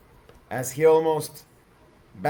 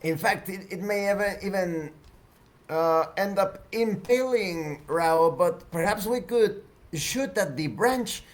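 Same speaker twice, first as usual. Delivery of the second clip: higher, halting character voice; Arisa; 5 semitones higher than usual. Acted. Woman suggests shooting at branch, higher character voice. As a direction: propose it in a raised pitch, with hesitations. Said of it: "Arisa exclaims".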